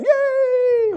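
very high, drawn-out squeal of yay; mock cheer. Storyteller imitating winners, high mock cheer. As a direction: hold a thin high cheer.

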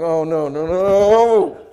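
loud, drawn-out mock protest; hammed-up dismay, long final word. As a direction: wail a comic no, rising and swelling. Retold protest at friend's singing.

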